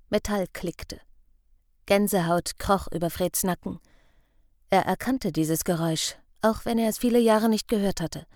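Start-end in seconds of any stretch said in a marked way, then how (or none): none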